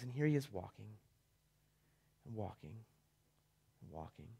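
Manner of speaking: fades from soft to nearly silent; fading walk. A narrator's reading. To listeners, picturing the son's long walk, fading to a hush. Walking described, fading away.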